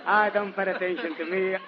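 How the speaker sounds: ordinary delivery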